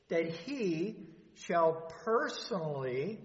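personally drawn out slowly with emphasis; stress on personally. Real, not acted. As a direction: lean hard on "personally", stretching it out. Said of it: "I put an emphasis on that word".

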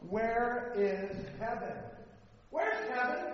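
high, loud rhetorical question; emphatic preaching question. Real, not acted. Preacher asking listeners where heaven is, loud and emphatic. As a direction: call the question out to the congregation.

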